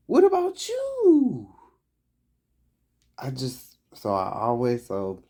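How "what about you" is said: high, loud outburst on a question; shrieked-up, much higher question; loud exclaimed question. Acted. Acted quote, pressed question.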